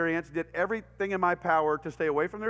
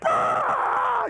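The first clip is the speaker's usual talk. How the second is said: long high wailing cry, much louder; delayed wail; 5 dB louder than usual. Shown as a demonstration. A kid whose head is cut, a delayed high wail. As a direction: let the cry burst out after rising from the feet. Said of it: "there's the delayed cry".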